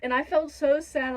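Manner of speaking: ordinary delivery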